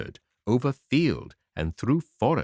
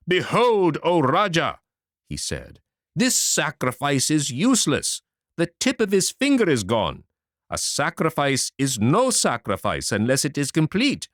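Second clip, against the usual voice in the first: loud, higher furious priest; angry; 6 semitones higher, 3 dB louder than usual. Acted. Chief Brahman furiously protests to the Rajah, loud and outraged. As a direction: protest the spoiled sacrifice in a rage. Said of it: "he got very red and angry indeed".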